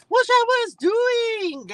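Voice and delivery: high, slow, stretched-out hello call; sing-song greeting. Real, not acted. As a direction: stretch each word up high like a catchphrase. Signature greeting to live viewers.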